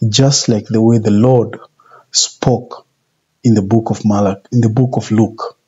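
ordinary delivery

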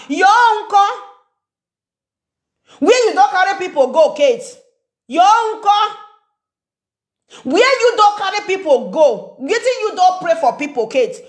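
confrontational questioning. Real, not acted. Vlogger demanding where they carried people, confrontational questioning. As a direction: demand answers in a high, insistent voice. Repeated challenge to person addressed.

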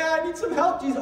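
high, loud plea to Jesus; calling out for help. Retold or imitated. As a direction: cry out for help.

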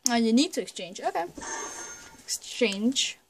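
ordinary talk, nothing marked

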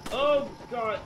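high and loud, begging in panic; panicked yelling. Real, not acted. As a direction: scream at Leon to run. Panicked yelling at game character.

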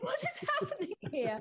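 high, laughing disbelief; high bewildered squeal with a laugh; incredulous. Retold or imitated.

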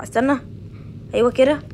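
ordinary talk, nothing marked

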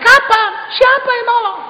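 very high, loud disbelieving question; incredulous. Acted. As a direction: ask in shocked disbelief. Man astonished, disputing Jesus.

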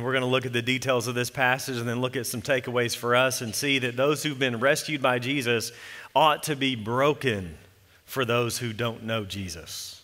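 ordinary talk

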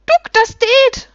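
very high, loud, drawn-out gnome cheer; shrieking little creatures. A narrator's reading. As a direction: yell it gleefully as jubilant little creatures. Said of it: "schrien sie".

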